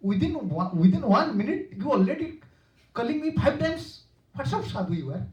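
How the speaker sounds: high, loud mock scolding; a mother scolding the sadhu, high and loud; chastising. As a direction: chide him sharply for calling so often. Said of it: "They are chastising Sanatana".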